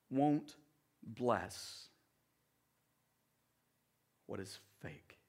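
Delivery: fading to hush. Real, not acted. Sermon climax fading to hush.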